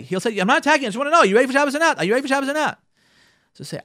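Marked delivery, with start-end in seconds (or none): 0.4-2.8 higher, louder, fast defensive protest; defensive husband. Retold or imitated. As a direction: insist he isn't attacking, impatiently. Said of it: "he will say".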